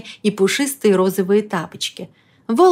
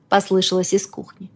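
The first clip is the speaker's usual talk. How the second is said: ordinary delivery, nothing marked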